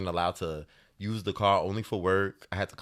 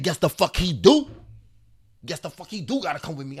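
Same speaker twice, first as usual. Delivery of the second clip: defiant aunt; 8 semitones higher, 3 dB louder than usual. Retold or imitated. Aunt cursing at boyfriend.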